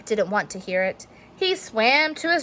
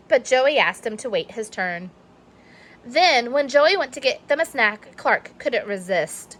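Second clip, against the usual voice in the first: ordinary delivery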